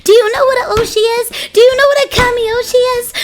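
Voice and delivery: high, worked-up excitement; overwhelmed fangirl. Real, not acted. Fan tells viewers about meeting her kamioshi, overwhelmed.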